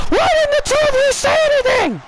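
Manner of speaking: high, furious accusing yell at her tag partners; angry Shotzi. Acted.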